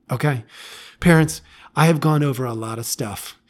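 normal talking voice

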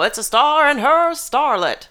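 high, slow newsreel announcer; old-timey announcer. Retold or imitated. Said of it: "that old-timey voice of saying".

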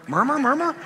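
high mock-bewildered muttering crowd; confused murmuring sailors. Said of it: "So be confused".

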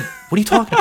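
mock-innocent rising question; fake innocence. Feigning innocence to dad.

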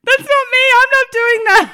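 high, loud mock panic; alarmed denial. Mother looking at midwife as pushing starts, high panicked denial. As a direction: cry out the disclaimer in acted alarm.

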